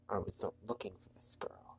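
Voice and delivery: breathy story whisper. Acted. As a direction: whisper it like a secret. Reading the story line whispered.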